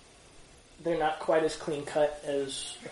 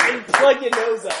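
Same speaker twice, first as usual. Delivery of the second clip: higher, louder mock New York accent; 6 semitones higher, 7 dB louder than usual. Shown as a demonstration. Teacher showing how the impression is done, New York accent. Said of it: "just have to do a New York accent".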